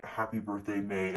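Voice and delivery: low, close man's voice, barely voiced; low whisper. Stranger whispering to Maeve on the dancefloor, low and close. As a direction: breathe it low into her ear. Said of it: "the person whispers in her".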